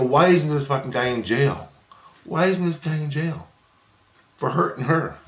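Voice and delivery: high, loud angry question; irate demand. Retold or imitated. Irate man demanding the abuser be jailed, high angry outburst.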